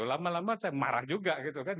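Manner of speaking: usual speaking voice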